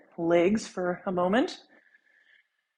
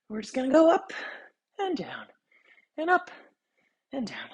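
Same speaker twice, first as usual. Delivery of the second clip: voice rides up and down; 5 semitones higher than usual. Real, not acted. Cueing bootstrapper up and down.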